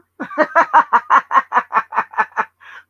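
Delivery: big high cackle bursting out; loud cackling laugh. Real, not acted. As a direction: laugh loud at the absurd message. Laughs at the message.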